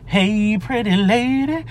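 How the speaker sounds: high, drawn-out playful greeting; playful calling out. Pretend. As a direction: stretch it out high, like a catcall. Calling out to a lady.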